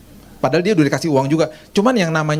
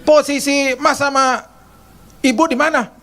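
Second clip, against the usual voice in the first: much higher, nagging, indignant; scolding maid; 11 semitones higher than usual. Retold or imitated. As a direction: nag like a bossy housekeeper. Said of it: "kayak gitu loh ngomongnya".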